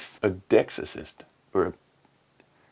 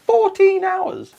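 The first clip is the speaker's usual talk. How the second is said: high, slow disbelief; mock-astonished exclamation; incredulous; 3 dB louder, slower than usual. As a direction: stretch it out in disbelief. Incredulous repeat of figure.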